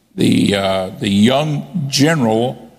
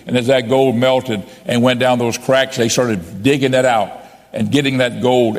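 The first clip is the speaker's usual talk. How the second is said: ordinary talk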